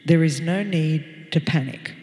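low, calm pilot on the loudspeaker; steady captain voice over the intercom; pilot announcement. Retold or imitated. Pilot calming the passengers, low steady announcement. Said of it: "the pilot comes over the loudspeaker and he goes".

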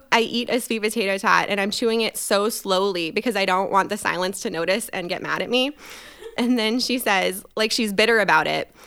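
ordinary talk

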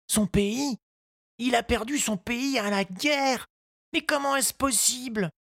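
put-on high, polite little boy's voice; a small boy asking politely; young boy Tistou. A narrator's reading. Tistou asks the gardener, high child voice.

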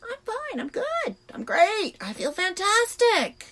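high-pitched mock-cheerful insisting; exaggerated fake-fine voice; brushing it off. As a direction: overdo being fine, way up high. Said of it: "I just brushed it off and said".

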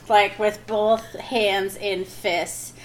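drawn-out with laughter; slow, laughing. Real, not acted. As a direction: stretch it out, laughing. Slow strained laughing description.

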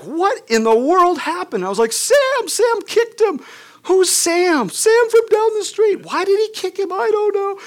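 very high, loud panicked squeal; hammed-up hysterical back-and-forth with mom; frantic shouting. Frantic retold mother and son exchange.

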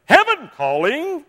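Brother Mays's greeting. Retold or imitated. Pastor answering the phone with his cheerful slow greeting. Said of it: "a special thing that he'd always say".

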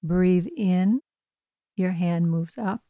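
calm, even bedtime meditation voice; guided meditation. Acted. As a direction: gently guide a listener toward sleep. Leads a bedtime breathing meditation.

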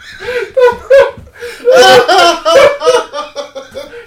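high shrieking laugh, loud; hysterical laughter. Real, not acted. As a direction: burst into helpless laughter. Guest just insulted the hosts.

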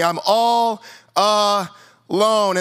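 very slow, stretched-out whine; mock self-pity. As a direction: drag it out like a sulking martyr.

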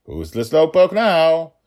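high, loud gloating tease; teasing dad. Acted. Father gloating as he passes daughter, high loud tease. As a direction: crow it as you pass her.

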